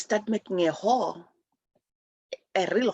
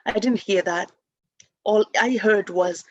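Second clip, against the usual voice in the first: usual speaking voice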